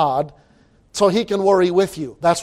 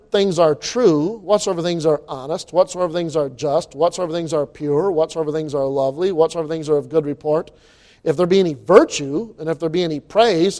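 quieter, measured reading of Philippians; solemn scripture reading; 4 semitones lower, 4 dB quieter than usual. Acted. Preacher reading Philippians aloud, soft and measured. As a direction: read it softly, like a cadenced litany.

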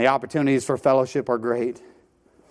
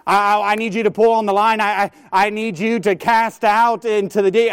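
raised, urgent pleading call; the Lord calling; 7 semitones higher, 4 dB louder than usual. Retold or imitated. The Lord to the congregation, urgent raised plea. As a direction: plead with the church to cast out.

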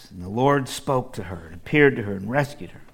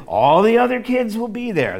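put-on higher childlike whining plea; boy insisting all his friends are going; pleading boy; 9 semitones higher, 6 dB louder than usual. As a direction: beg for it like a kid.

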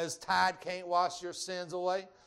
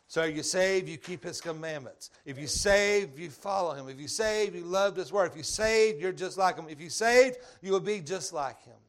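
rising, louder preaching cadence; preaching at full force; 5 dB louder than usual. Acted. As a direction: build the refrain, hammering saved. Preacher building the call.

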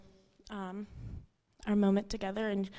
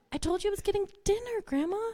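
much higher, fast mock exasperation; exasperated scolding; 11 semitones higher, faster than usual. Granddaughter scolds Grandma for eating ice cream, high and exasperated. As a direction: playfully scold her, pitched way up.

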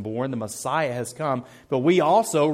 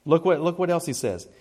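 ordinary talk, nothing marked

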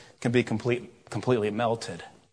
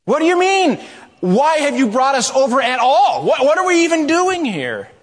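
high, loud, exasperated plea; Joshua asking; 10 semitones higher, 5 dB louder than usual. Retold or imitated. Joshua questioning God after crossing Jordan, high loud protest. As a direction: cry out in bewildered protest. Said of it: "Joshua is asking".

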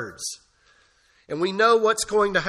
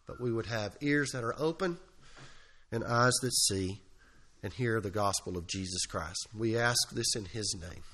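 soft, low prayer voice; closing prayer; 9 semitones lower, 11 dB quieter than usual. Real, not acted. Pastor closing a prayer before the sermon, hushed and reverent. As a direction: pray quietly, head bowed.

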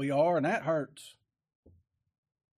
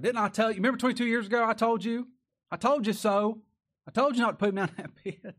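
raised, higher voice of reproach; scolding I-told-you-so; 8 semitones higher, 7 dB louder than usual. Acted. Reuben reproaching his brothers over Joseph, loud indignant scolding. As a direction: throw the blame back at them, loud and high.

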